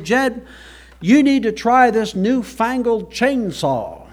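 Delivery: high, slow Southern drawl of a slick salesman; salesman's Southern accent. Retold or imitated. Salesman pitching a chainsaw to Jeb, Southern accent. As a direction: play the pushy country salesman with a twang. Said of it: "I don't know if that's a good accent or not".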